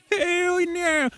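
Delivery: high, gleeful hammed-up monster; a cartoon beast cheering itself; mock monster glee. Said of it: "is just like".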